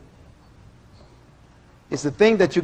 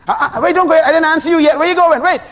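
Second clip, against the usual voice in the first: high, loud urgent call of God; God calling you back; 13 semitones higher, 8 dB louder, faster than usual. Acted. God pleading with a praying man to wait, high and loud. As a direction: call after them, don't let them leave.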